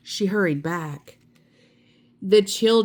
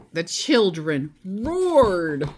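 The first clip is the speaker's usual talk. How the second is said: ordinary delivery, nothing marked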